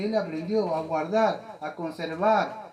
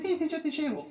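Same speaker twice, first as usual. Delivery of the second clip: pushover father; 10 semitones higher than usual. Retold or imitated. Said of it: "El padre dice".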